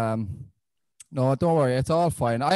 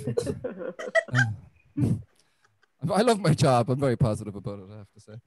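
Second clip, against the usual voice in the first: ordinary delivery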